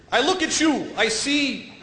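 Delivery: hammed-up poem recital, lines drawn out; mock poet. Guy reading his poem at a competition, mock-poetic.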